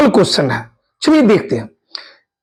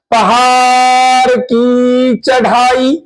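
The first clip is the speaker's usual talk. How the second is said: slow emphasis; 2 semitones higher, much slower than usual. Shown as a demonstration. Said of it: "teacher stressing the key phrase".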